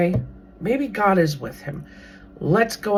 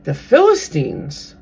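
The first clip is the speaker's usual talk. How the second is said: normal talking voice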